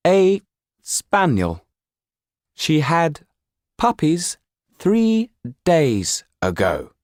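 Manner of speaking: a puppet voice thrown from the shadows; the Pierrot answering. Acted.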